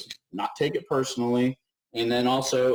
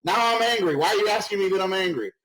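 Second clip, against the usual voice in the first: much higher and louder, hammed-up indignant yell; mock angry outburst; 10 semitones higher, 4 dB louder than usual. Presenter jokingly acts out getting angry at her team. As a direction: get worked up and boil over for a laugh. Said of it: "my passionate voice often sounds like my angry voice".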